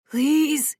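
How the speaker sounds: begging. Acted. Boy begging paramedic to see her, pleading cry. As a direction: plead with everything left. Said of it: "he begged, his voice barely a wheeze".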